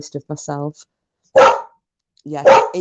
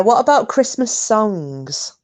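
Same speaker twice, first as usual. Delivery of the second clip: normal talking voice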